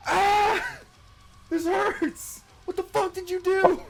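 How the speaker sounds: very high, strained squeal of pain; mock pained scream. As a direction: wail it in exaggerated agony. Acts out noticing the broken pinky.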